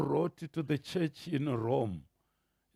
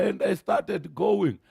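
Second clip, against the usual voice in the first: normal talking voice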